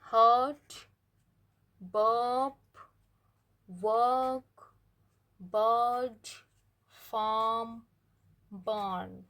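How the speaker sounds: drawn-out vowel, r left silent; long vowel, no r sound; long er vowel demo. Shown as a demonstration. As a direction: stretch the vowel in हर्ट and बर्ड long, r silent. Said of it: "जैसे कि ये words हैं".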